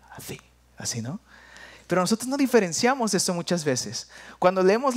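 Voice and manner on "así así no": whisper. Shown as a demonstration.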